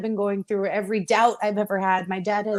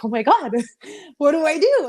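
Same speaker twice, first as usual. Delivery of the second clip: retold overwhelmed reaction, pitched up with a laugh; higher, laughing mock panic; giddy disbelief; 6 semitones higher, 4 dB louder than usual. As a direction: relive the shock, laughing through it. Reliving getting the big call.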